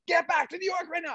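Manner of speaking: high, strained angry order; boss losing his mind. Retold or imitated. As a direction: imitate the raging boss giving an order. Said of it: "And he lost his mind".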